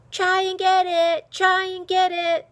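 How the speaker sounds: high, loud playground taunt; loud sing-song teasing; taunting call. A narrator's reading. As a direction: call it out to goad someone into chasing. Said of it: "Aaron called out".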